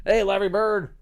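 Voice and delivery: cheerful pet call; calling the bird. Real, not acted.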